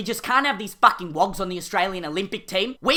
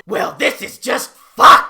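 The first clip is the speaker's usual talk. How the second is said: ordinary talk, nothing marked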